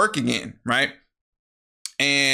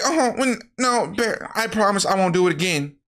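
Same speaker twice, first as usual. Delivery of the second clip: higher, sweet-voiced promise; pleading girlfriend; 6 semitones higher than usual. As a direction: play innocent and beg your boyfriend. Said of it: "Nikki's just like".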